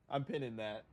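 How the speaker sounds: ordinary talk, nothing marked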